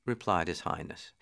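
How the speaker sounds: ordinary delivery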